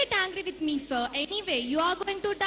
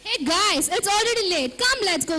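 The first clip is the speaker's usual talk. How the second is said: calling out; 6 dB louder than usual. Acted. Girls hurry off to exam.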